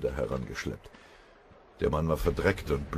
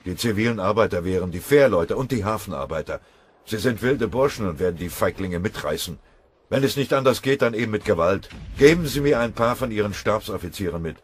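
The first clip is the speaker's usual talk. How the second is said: loud, higher, hard-edged orders; forceful planning; 6 semitones higher, 8 dB louder than usual. Acted. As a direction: drive the plan through with force. Kriegsrat plant Einsatz.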